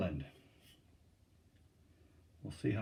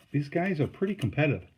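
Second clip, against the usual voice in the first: plain reading voice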